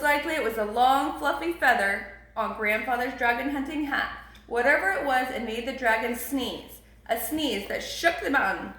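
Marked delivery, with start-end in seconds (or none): none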